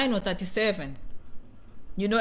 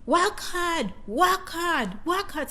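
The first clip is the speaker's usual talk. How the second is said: higher, clipped chant of work hard; higher mock chant of work hard; imitating Janet; 6 semitones higher, much slower than usual. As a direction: mimic a boss drilling the team, higher. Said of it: "Janet saying".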